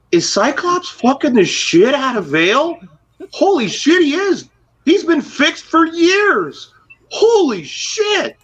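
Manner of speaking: shocked. Retold or imitated. Retold shocked discovery.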